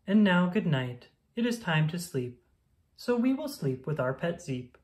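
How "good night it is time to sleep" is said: usual speaking voice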